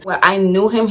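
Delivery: ordinary talk, nothing marked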